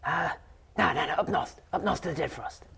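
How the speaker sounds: a hint of a prankster correcting his mate; lightly voiced plotter. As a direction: overrule your fellow plotter, lightly voiced. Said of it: "you hear them whispering to each other".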